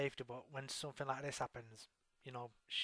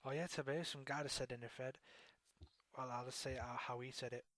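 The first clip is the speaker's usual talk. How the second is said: ordinary talk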